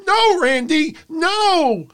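high-pitched hammed-up cry of no; squeaky mock protest; mock high wail. Mimicking referee pleading.

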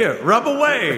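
high, loud salesman voice. Pretend. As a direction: hawk it like a sly seller. Genie seller scene.

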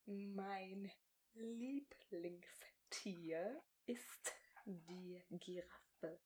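very slow, barely voiced words, stretched out; over-articulate each word slowly, almost silently; exaggerated mouthing. Shown as a demonstration. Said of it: "Ich schreie halt einfach".

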